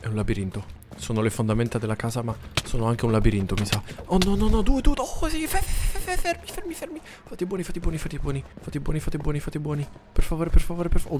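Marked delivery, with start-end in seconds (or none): 4.1-11.2 very high, frantic begging; terrified pleading. Real, not acted. Gamer chased by fast monsters, very high frantic pleading. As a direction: plead frantically as they rush you.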